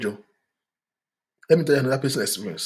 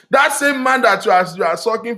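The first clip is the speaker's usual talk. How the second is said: loud outburst; 8 semitones higher, 6 dB louder than usual. Real, not acted. Heated preaching outburst.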